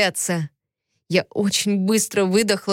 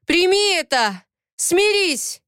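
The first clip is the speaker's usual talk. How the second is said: high, forceful commands; heated outburst climbing in pitch; angry yelling; 10 semitones higher, slower than usual. A narrator's reading. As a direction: order her to give in. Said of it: "заорал он".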